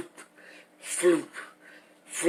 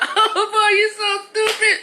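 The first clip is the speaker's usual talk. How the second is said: much higher, laughing flirty voice; giggly woman. Retold or imitated. Imitating a woman laughing at his joke, high giggly. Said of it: "she's like".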